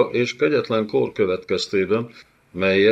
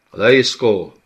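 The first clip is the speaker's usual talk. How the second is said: "Leiszkó!" loud and high; thundering call; 4 semitones higher, 9 dB louder than usual. Acted. Zaid Haram thunders for his servant. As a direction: thunder the name across the hall. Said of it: "mennydörögte Zaid Haram".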